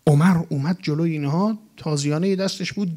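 ordinary talk, nothing marked

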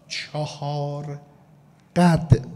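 چهار قد said as two slow, separate words; drawn-out syllables. Shown as a demonstration. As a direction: pull apart چهار and قد with a pause between. (شمرده و کشیده: «چهار ... قد»)

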